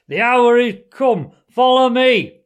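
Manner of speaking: loud, high rallying shout; battle cry. A narrator's reading. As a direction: lead the charge at full voice. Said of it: "glanced round at his comrades and cried".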